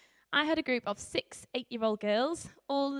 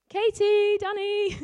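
calling loudly and higher across the maze; 9 semitones higher, 8 dB louder, slower than usual. Retold or imitated. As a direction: shout the names to find lost kids. Calling lost girls in maze.

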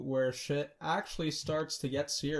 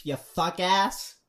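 louder, drawn-out mock insult; slow, drawn-out insult; 4 semitones higher, 7 dB louder, much slower than usual. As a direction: spit the insult out slowly. Acting out Donnie's insult.

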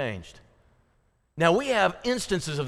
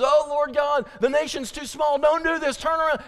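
begging prophet; 10 semitones higher than usual. Acted. Prophet begging God again.

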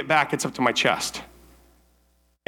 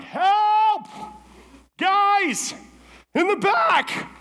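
calling for help; much slower than usual. Retold or imitated. Stuck in mud, yelling to the workers for help. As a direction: shout to the guys round back.

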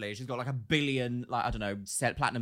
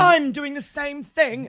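high, loud, stretched-out caricature; mock entitlement; 12 semitones higher, 11 dB louder, much slower than usual. Joining in the mockery of an ex-friend, high and loud. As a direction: proclaim it slowly in an overblown high voice.

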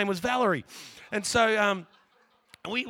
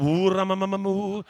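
louder chant in tongues; imitating tongues, louder; 10 dB louder than usual. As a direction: let it roll out like praying in tongues. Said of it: "And she's like".

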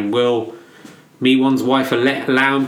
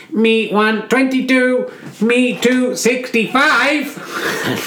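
stiff robot announcer reading scores; 7 semitones higher, much slower than usual. Pretend. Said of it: "I'll let Robot Voice do it".

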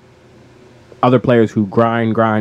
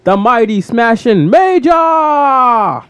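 epic introduction; 11 semitones higher, 4 dB louder, slower than usual. Pretend. Co-host introducing the episode's two contenders, epic announcer shout. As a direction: ring announcer's high, loud, drawn-out shout. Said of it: "thanks for that epic introduction".

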